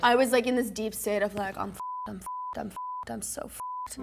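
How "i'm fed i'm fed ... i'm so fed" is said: mock inner panic. Retelling inner panic mid-challenge.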